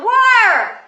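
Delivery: loud high shout of war; triumphant game shout; shouted call. Retold or imitated. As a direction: call it out like slamming a card down. Friend calls out in card game.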